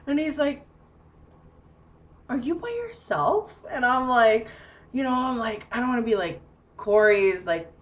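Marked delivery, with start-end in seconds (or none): 2.3-3.6 imitated wary question, pitched well up; high, tentative mimicked question; retold puzzled visitor. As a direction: ask delicately in a much higher voice. Visitor puzzled she is alone.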